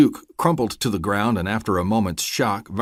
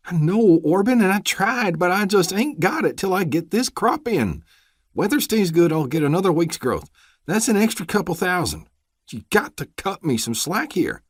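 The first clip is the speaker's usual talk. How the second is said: pleading farmer; 12 semitones higher, 4 dB louder than usual. Acted. Farmer begging the deputy for slack, high and nervous. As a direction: wheedle for more time, nervous.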